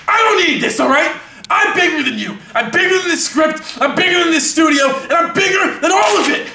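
high, shouted tirade; enraged star puffing himself up; furious boasting. Acted. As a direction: build each boast into a bigger shout. Actor erupts at insult.

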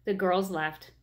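ordinary delivery, nothing marked

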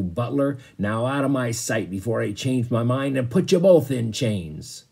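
loud, barking captain's orders; gruff sea captain. Acted. Sea captain orders the butler to the coal bunkers, loud gruff scolding. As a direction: lay down the law to the butler.

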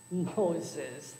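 a mild mocking chuckle on the name; laughing. A narrator's reading. Burglar repeats the parrot's answer, mild mocking laugh. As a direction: scoff at the name lightly. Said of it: "the burglar laughed".

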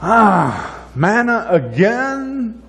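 high, slow, drawn-out mock whine; whiny complaint. Israelites whining to Moses about manna, whiny. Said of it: "They're like".

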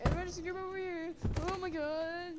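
high, urgent panicky voice; acted panic. Character calling the others over, panicked.

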